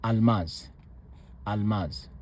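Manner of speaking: almaz said softly with a quick stop after the l; correct version. Shown as a demonstration. Teacher settling on the right pronunciation. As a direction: say almaz with a split-second break after the l.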